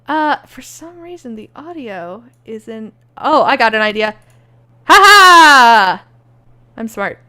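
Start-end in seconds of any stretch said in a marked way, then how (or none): 4.8-6.0 loud gloating ha-ha; triumphant laugh. Acted. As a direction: cackle at your own cleverness. Triumphant at his own idea.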